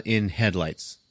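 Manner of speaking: usual speaking voice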